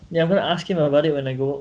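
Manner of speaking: normal talking voice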